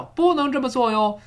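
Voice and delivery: 不能 pitched high and stressed; emphatic 不能. Shown as a demonstration. Teacher stressing the negative for learners, 不能 emphasised high. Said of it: "它一定要强调".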